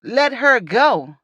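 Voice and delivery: lightly acted police order; mock police standoff demand; police officer. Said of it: "like the police".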